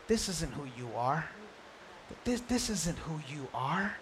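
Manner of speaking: almost breathe it to comfort him; tender reassurance. Retold or imitated. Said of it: "And then said".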